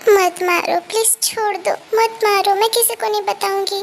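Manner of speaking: frightened pleading. Acted. Girl talking in her sleep promises to tell nobody, frightened pleading. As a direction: plead as if trapped in a nightmare.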